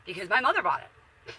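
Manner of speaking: ordinary delivery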